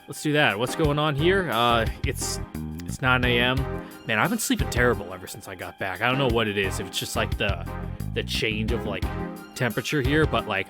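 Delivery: ordinary talk, nothing marked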